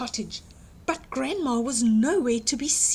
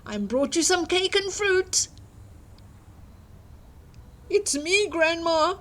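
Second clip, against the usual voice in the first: higher, wheedling fake girl voice; wolf faking a girl; 7 semitones higher than usual. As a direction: put on a sweet little girl act.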